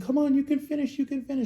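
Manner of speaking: high mock-motherly pep talk; encouraging mom. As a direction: cheer a tired kid on, sweetly insistent. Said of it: "then she's like".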